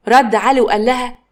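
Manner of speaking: usual speaking voice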